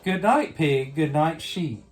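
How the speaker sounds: usual speaking voice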